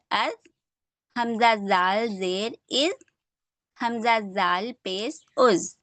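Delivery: slow spelled-out drill of letters with vowel marks; teacher drilling zabar, zer and pesh. Shown as a demonstration. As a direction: spell each syllable deliberately, one at a time. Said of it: "drilling vowel marks slowly".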